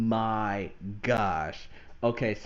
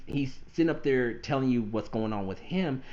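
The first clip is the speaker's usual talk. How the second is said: ordinary delivery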